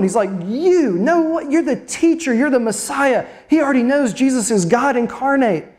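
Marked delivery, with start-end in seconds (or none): 0.5-3.3 incredulous, pitched-up protest; mind blown. Acted. Simon Peter balking at his teacher serving him, mind blown. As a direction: blurt it in high-pitched disbelief. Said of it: "his mind is blown".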